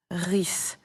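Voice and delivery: drawn-out ris ending; syllable stretched out. Shown as a demonstration. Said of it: "transformer la terminaison heure en".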